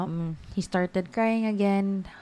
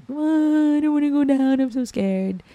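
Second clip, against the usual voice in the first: high, loud mock wail easing off at the end; imitated crying child; 8 semitones higher, 5 dB louder than usual. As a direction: wail like a scared little boy, acted out. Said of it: "he really was crying".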